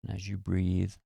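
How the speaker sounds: calm, even meditation voice with long pauses; guided meditation. Acted. Host leading listeners through a relaxation meditation, calm and unhurried.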